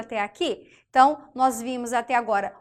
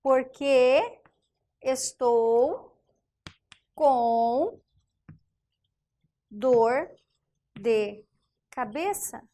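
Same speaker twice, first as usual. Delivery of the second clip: slow dictation; much slower than usual. Shown as a demonstration. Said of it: "Por quê?".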